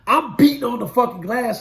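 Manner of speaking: ordinary talk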